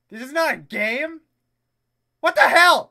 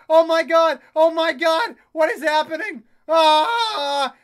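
loud, high, astonished shouting; frantic, thrilled disbelief; overwhelmed excitement; 4 semitones higher, 8 dB louder than usual. Real, not acted. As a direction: shout as if reality is falling apart. Speedometer goes wild in game.

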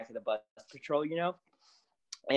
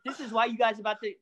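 amused disbelief; 7 semitones higher than usual. Retold or imitated. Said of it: "I was like".

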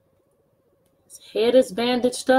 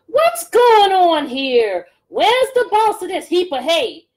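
high, slow and loud hen, demanding; a fussy chicken calling out for the boss; squawky chicken voice; 7 semitones higher, 7 dB louder, slower than usual. Acted. Teacher voicing a chicken asking for the farmer, high and loud.

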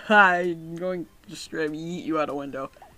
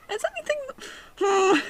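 voiced character. Voicing a worried character.

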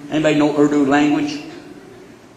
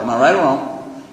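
ordinary delivery

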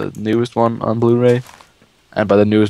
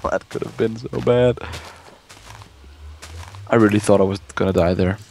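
ordinary talk

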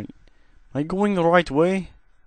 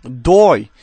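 shout; 4 semitones lower, 7 dB louder than usual. Real, not acted. Shooting at zombie in game.

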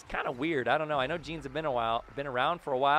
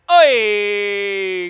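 higher, louder exclamation; 6 semitones higher, 17 dB louder than usual. Real, not acted. Gamer celebrating another goal, loud oi. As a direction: bark it out in triumph. Scores again.

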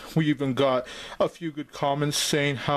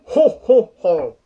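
Santa laugh; 2 semitones higher, 7 dB louder, slower than usual. Acted. Santa laugh after dirty joke.

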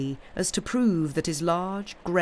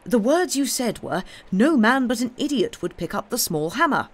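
agitation barely mastered; 6 semitones higher, 4 dB louder than usual. A narrator's reading. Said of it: "mastering his discomposure".

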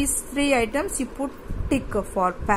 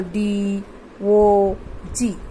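each letter of the word spelled out slowly; teacher spells dog letter by letter for pupils, slowly; slow spelling; 2 semitones lower, much slower than usual. Shown as a demonstration. Teacher dictates the letters of dog to the class, slowly. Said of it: "We can get the word dog".

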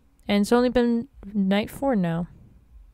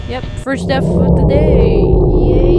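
louder, mock-happy cheer; sarcastic cheer; 4 semitones higher, 8 dB louder than usual. Real, not acted. Gamer mocks his own first death with a sarcastic cheer.

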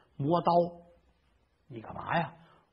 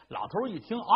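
usual speaking voice

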